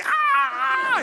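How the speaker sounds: very high shouted ah yelp; imitated yelp. Fan hit by a glow stick, a very high shouted yelp. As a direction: squeal like the stunned fan. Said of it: "And the person is like".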